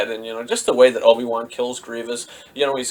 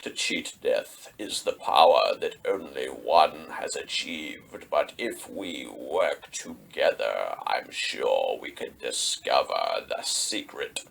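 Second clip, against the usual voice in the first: much lower, softer, slow seductive villain; sinister Palpatine; 11 semitones lower, 9 dB quieter, slower than usual. Retold or imitated. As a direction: tempt him slowly and quietly. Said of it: "He's just like".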